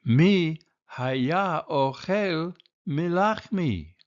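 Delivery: high, loud Mama Bear call; קול גדול של אמא דובה; big Mama Bear voice. A narrator's reading. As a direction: cry out like a startled mother bear. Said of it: "ויאמר אם דובה בקולה הגדול".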